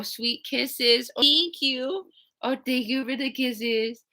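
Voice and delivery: high, sweet thanks to the dog; a lighter high pet voice. Real, not acted. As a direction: coo back at the licking puppy. Dog kisses her.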